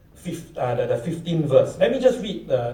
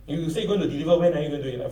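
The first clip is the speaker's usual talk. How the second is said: normal talking voice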